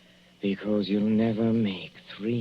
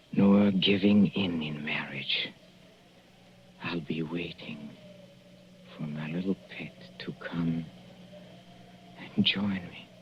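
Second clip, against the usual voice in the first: soft whisper, much quieter; low-volume sinister whisper; menacing whisper; 4 semitones lower, 5 dB quieter than usual. Acted. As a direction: whisper it to her like a curse from the grave. Dying poisoner whispers to his wife.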